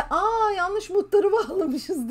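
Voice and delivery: mock surprised announcement; laughing mock surprise. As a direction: mimic a sheepish broadcaster. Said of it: "diye yayın yaptılar".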